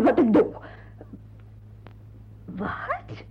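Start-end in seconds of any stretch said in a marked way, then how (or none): 2.4-3.2 gasped what. Acted. Girl refuses to marry him.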